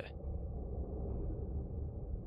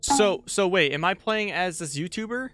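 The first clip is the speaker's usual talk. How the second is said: normal talking voice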